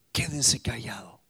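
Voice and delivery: low whisper; whispered command. Retold or imitated. God quieting the Israelites, low whisper. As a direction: hush them, barely voiced.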